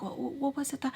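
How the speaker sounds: fake nonchalant. Mimicking the woman's probing question, fake nonchalant. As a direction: mimic her softly stumbling into a casual question. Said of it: "trying to be, like, nonchalant".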